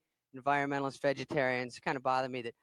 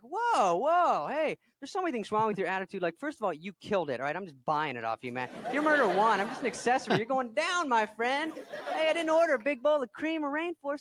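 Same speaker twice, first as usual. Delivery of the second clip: mock defensive; 6 semitones higher than usual. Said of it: "I'm like".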